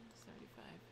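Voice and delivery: breathy whispered number; whispering. Real, not acted. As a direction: say it under your breath. Quiet aside while donating bits.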